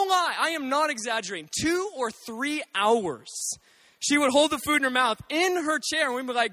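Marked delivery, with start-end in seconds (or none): none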